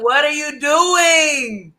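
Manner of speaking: put-on high, drawn-out kid's whine; little brother. Little brother walks in on his sister, high drawn-out alarm. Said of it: "my little brother walked in".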